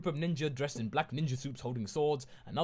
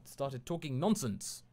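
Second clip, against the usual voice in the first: ordinary delivery, nothing marked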